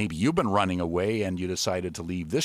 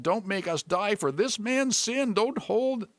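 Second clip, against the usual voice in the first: pleading; 7 semitones higher than usual. A narrator's reading. Sailors begging the Lord to spare them, pleading. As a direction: beg in a high voice, gradually settling. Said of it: "they pleaded".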